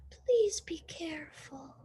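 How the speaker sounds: soft, breathy plea; worried whisper. A narrator's reading. Baby pleads with her sister in a worried whisper. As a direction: whisper the warning anxiously.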